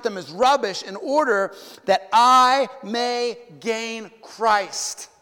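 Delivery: slow, loud, weighty scripture reading. Acted. Preacher reads Paul's letter aloud to the church, slow and loud. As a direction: lean hard on every phrase of the verse.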